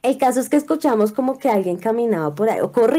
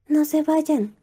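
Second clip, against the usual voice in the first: put-on child voice; 6 semitones higher, much slower than usual. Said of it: "en voz de niño".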